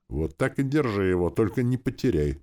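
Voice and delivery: plain reading voice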